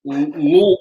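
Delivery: ordinary delivery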